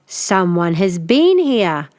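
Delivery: slow, heavy bear growl; growling father bear. Acted. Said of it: "Father Bear growled".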